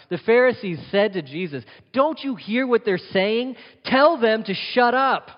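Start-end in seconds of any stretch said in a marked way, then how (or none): 1.9-5.3 indignant Pharisee. Retold or imitated. Pharisees scold Jesus, acted quote.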